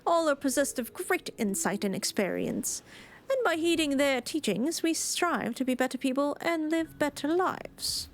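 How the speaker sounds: put-on light British accent, formal islander. Islander explaining the gods, in a light British accent. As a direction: lay on a polite British accent. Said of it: "gave this random one a British accent".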